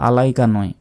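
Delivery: usual speaking voice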